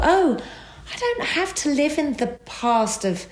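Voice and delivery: a light-bulb moment, voice jumping up; sudden realisation. Retold or imitated.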